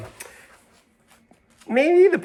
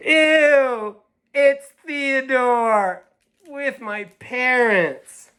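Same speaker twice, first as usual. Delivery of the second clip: high, drawn-out disgust; 12 semitones higher, 5 dB louder, much slower than usual. Acted. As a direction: squeal it in disgust. Child disgusted at brother.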